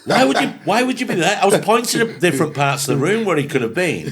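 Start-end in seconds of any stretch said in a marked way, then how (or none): none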